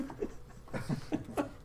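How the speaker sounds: breathy, high giggle; real suppressed fit of giggles; real laugh. Real, not acted. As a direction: crack up helplessly, mostly voiceless. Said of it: "Excuse me for laughing".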